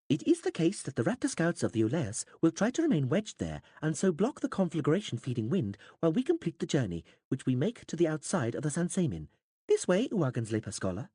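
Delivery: high, stiff, formal alien voice; alien interpreter. A narrator's reading. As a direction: explain it like a pedantic alien interpreter.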